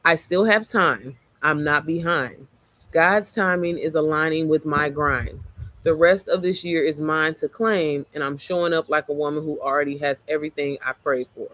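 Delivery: slowed down, each line spoken out carefully; slow, deliberate affirmation read. Shown as a demonstration. Reading her affirmation to her viewers, slowly and deliberately. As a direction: read it slowly like a vow to yourself. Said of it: "let me stop trying to rap it".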